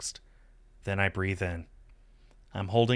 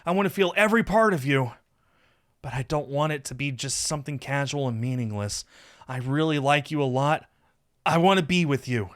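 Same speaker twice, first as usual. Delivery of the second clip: higher, heartfelt declaration of desire; earnest confessing lover; 6 semitones higher, 5 dB louder than usual. A narrator's reading. Lover confessing he wants to be with him, earnest. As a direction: pour out a love confession.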